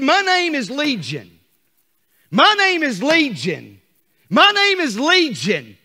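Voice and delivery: loud, high, slow demon declaration; demon's answer. Acted. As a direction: let the demon boast its name. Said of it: "He said".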